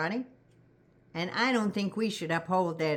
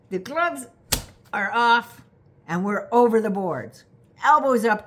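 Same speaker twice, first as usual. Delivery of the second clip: loud rally cry; 5 dB louder than usual. Real, not acted.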